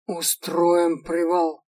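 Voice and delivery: menacing orc bellow; slow, loud, commanding orc call; barbarian. Acted. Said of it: "прозвучал грозный голос орка".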